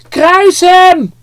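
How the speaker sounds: high, loud mob cry; shouted. Acted. One man in the crowd calls out to Pilate, shouting high and loud. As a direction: scream it from the crowd. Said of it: "Iemand riep het al".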